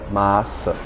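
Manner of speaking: normal talking voice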